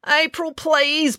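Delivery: high, slow drawn-out plea; pleading. A narrator's reading. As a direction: beg her to stop. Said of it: "pleaded Finn".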